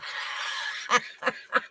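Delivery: airy embarrassed giggle; soft unvoiced chuckle; breathy laugh. Real, not acted. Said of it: "What did I say?".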